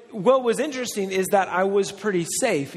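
normal talking voice

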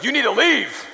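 high, loud imitation of an angry wife; imitating wife's stern voice. Wife throwing the salesman out, imitated loud fury. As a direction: order him out in her rare scary voice. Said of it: "I've only heard that voice a couple times".

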